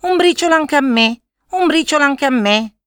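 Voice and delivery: high, loud chattering magpie; gazza supplicante. A narrator's reading. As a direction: beg in a high-pitched bird voice. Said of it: "nel suo roco cicaleccio". (gazza che supplica con voce acuta)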